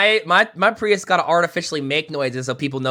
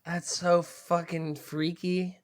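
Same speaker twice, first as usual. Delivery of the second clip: slow, quiet disgust; 2 semitones higher, 7 dB quieter, much slower than usual. Real, not acted. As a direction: recoil at something gross. Disgusted reaction.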